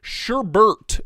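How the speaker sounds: "Sherbert", a clear R before the final T; sherbert with an extra R. Shown as a demonstration. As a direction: say "Sherbert" with an R in both syllables. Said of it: "is what we always said".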